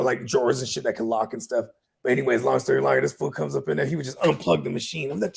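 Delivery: normal talking voice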